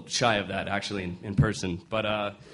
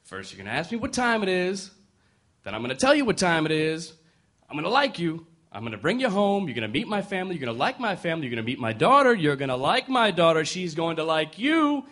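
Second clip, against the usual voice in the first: put-on ranting old man; 6 semitones higher than usual. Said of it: "The old man says".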